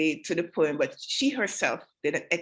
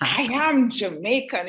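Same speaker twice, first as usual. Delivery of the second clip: slow, proud imitation of a recital; strutting imitation; slower than usual. Judge imitating the young contestant, proud strutting recital. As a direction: strut it out, stretching I AM. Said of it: "she just strutted".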